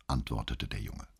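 ordinary delivery, nothing marked

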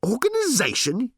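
very high, incredulous yell; outraged, high-pitched echo; yelled disbelief. A narrator's reading. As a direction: yell the word back in outrage. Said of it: "yelled Ford".

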